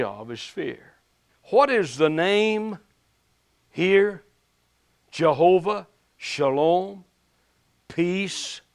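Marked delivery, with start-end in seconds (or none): 1.5-8.6 slow, rising preacher's cadence; preaching. Acted. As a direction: preach it with fervor. Preaching on Jehovah Shalom.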